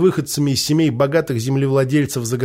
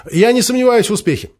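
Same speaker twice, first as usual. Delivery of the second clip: higher, bright eager officer; 8 semitones higher than usual. Acted. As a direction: say it high and keen.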